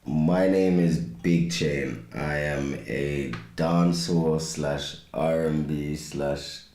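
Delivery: ordinary delivery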